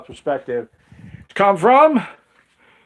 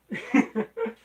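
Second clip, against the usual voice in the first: short high-pitched laugh; high giggle. Real, not acted. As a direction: burst into a squeaky giggle. Laughing at the tacky album.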